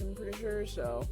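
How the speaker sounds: normal talking voice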